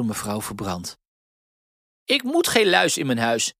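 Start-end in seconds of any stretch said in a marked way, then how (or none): 2.0-3.5 a hint of a harsh old woman, higher and louder; sneering at lice; mock disdainful old lady; stern old mistress. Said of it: "had ze erbij gezegd".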